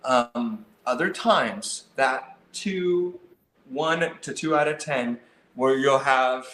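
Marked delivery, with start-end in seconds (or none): none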